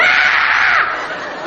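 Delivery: a sudden high-pitched scream of shock; high shriek. Acted. Shop girls shrieking at a rude customer, high scream. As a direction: shriek like a startled shop girl.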